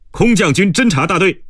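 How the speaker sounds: high, level cadet's answer; military report. Acted. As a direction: state your unit to a superior, pitched high. Cadet answering his commander. (军人报告式高声回答)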